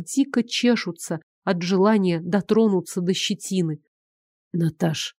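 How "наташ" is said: low, tender call of her name; hushed, pleading address; quiet, heartfelt. A narrator's reading. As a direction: call her softly and intimately. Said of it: "голос такой тихий, проникновенный".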